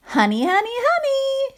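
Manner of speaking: bright sing-song bird call, rising high; cried, grinning. Acted. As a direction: sing it out like a cheeky little bird. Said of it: "she cried, grinning".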